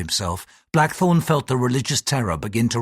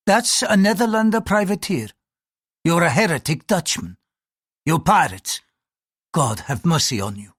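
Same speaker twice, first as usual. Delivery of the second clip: higher-pitched accusing priest; hostile priest; 6 semitones higher than usual. Acted. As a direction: accuse him as a suspicious priest.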